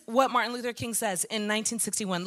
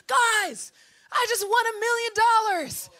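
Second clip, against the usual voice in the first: higher, bright news to the group; preacher playing a friend texting big news, higher and excited; excited announcement; 7 semitones higher than usual.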